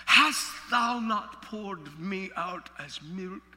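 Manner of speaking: very slow, measured King James reading; grave Bible recitation; solemn scripture reading. Acted. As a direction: read it like holy writ, drawn out. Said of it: "Job's cry to God, he said".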